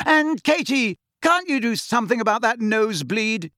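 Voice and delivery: exasperated call. A narrator's reading. Captain to bleeding teammate on the pitch, exasperated call. Angelina calling to Katie, exasperated.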